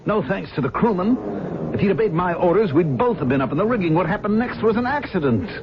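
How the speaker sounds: insist, getting worked up; agitated. Acted. Mate defends himself heatedly.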